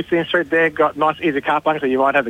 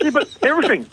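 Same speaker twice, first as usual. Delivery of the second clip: imitating a squealing, much higher girl's voice; excited young girl; 12 semitones higher, faster than usual. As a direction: gush like a girl who can't believe the bargain. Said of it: "she was hyperventilating".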